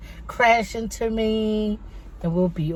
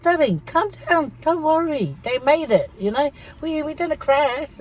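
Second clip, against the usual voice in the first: soothing; 6 semitones higher than usual. Retold or imitated. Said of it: "And I go".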